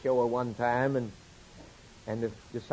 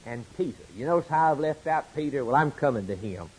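ordinary talk

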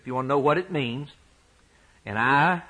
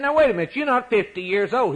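higher, louder protest; indignant objection; 10 semitones higher, 3 dB louder, faster than usual. Retold or imitated. Crowd objecting to Jesus in indignant disbelief. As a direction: cut him off in disbelief.

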